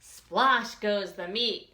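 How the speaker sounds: loud, lively read-aloud with slow punchy refrains; reading to children. A narrator's reading. Teacher reading a picture book to children, lively and loud. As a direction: read it big and bouncy for the kids.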